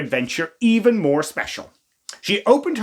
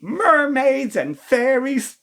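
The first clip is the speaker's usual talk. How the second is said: dreamy wonder; 7 semitones higher, slower than usual. A narrator's reading.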